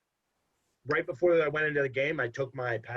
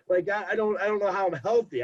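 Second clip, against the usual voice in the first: higher, louder incredulous outburst; exasperated disbelief; 7 semitones higher, 4 dB louder than usual. Real, not acted. Exasperated after laugh.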